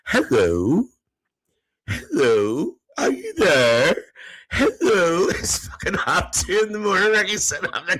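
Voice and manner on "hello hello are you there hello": drawn-out hellos swooping up at the end; questioning phone hello. Retold or imitated. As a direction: call into a silent line, imitating. Acts out answering phone at night.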